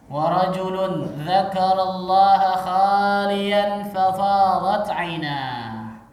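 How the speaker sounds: slow, measured recitation with drawn-out syllables; Arabic hadith recitation. Acted. Preacher quoting a hadith to the congregation, measured recitation. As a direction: recite it slowly and firmly, stretching the long vowels.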